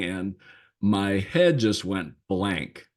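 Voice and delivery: normal talking voice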